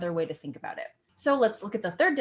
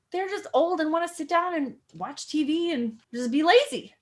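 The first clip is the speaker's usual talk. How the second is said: higher, mocking sing-song about lazy old folks; 8 semitones higher, 5 dB louder than usual.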